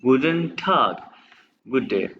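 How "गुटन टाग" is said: गुटन टाग drawn out very slowly, each syllable clear; slow careful गुटन टाग; slow German demo. Shown as a demonstration. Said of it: "आप हर word को pronounce करें".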